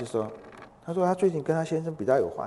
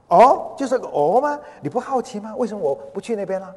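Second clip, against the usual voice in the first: high, incredulous questioning; indignant echo, then prodding questions; affronted disbelief; 7 semitones higher, 7 dB louder than usual. Retold or imitated. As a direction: act out a husband piqued by indifference. Husband annoyed at indifferent reply.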